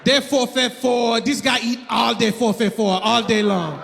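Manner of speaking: imitated Haitian accent, rattling off a list. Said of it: "he likes to rattle off what he thinks I'm eating".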